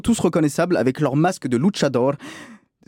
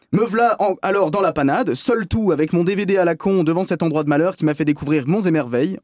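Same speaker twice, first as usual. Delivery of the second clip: ordinary talk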